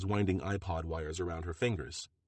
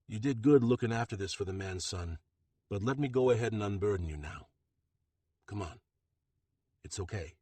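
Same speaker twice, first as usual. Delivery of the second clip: softening to near-hush by the end; gentle. A narrator's reading. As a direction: coax the arm from him tenderly. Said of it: "voice is gentler".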